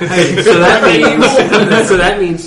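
loud, laughing realization. Real, not acted. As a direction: laugh it out mid-sentence.

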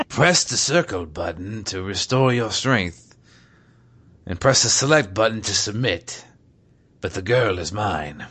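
Ocelot voice. Retold or imitated. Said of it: "Ocelot explains".

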